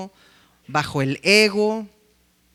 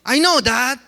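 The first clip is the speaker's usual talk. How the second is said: high, loud, whiny "I know" over and over; impatient kid; 8 semitones higher, 6 dB louder than usual. Retold or imitated. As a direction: imitate a teenager brushing off mom and dad. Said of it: "sus hijos siempre le dicen".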